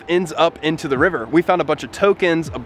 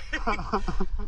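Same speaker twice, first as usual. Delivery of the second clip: soft giggle; 4 semitones higher, 8 dB quieter than usual. Real, not acted. Relief after the prank.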